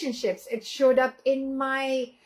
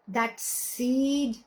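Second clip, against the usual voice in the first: normal talking voice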